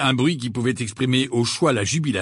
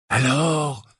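higher, insistent question; pressing; 4 semitones higher, 3 dB louder than usual. A narrator's reading. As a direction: press for the answer. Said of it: "d'une voix pressante". (voix pressante)

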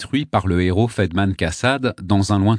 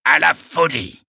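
high, ringing proclamation; proposing a toast; 8 semitones higher, 4 dB louder than usual. A narrator's reading. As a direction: call out the toast with flourish. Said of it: "en train de porter un toast".

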